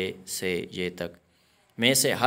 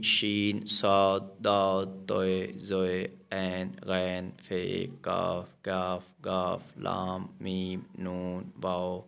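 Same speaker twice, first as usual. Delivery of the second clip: slow alphabet recitation; 2 semitones lower, slower than usual. Shown as a demonstration. Teacher reciting all thirty-seven letters to students, slowly. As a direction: spell out the letters one by one for the students.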